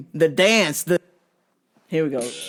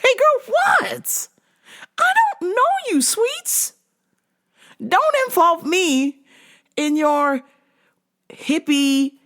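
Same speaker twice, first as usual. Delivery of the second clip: high, fast, sassy brush-off; mock high-pitched retort; 6 semitones higher than usual. To the odd customer, a high mock brush-off. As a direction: squeak it out like you're cornered. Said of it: "And I'm like".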